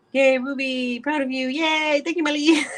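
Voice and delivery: high, gleeful yay with a laugh at the end; excited high-pitched congratulations; delighted cheering. Real, not acted.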